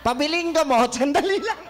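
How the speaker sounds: laughing through a pretend request at the shop; mock customer buying medicine. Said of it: "huwag kayong bibili ng gamot". (natatawang kunwaring bumibili ng gamot)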